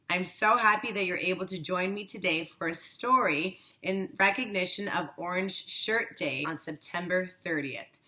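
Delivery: ordinary delivery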